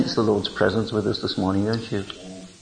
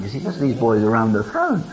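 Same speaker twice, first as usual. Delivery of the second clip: usual speaking voice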